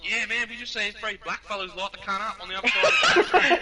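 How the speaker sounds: loud, high, mock-earnest tall tale; excited alibi. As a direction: sell the lie to the firemen. Acting their excited lie to firemen.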